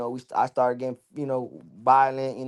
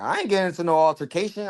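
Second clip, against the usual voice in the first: defiant denial; 4 semitones higher than usual. Retold or imitated. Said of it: "I'm like".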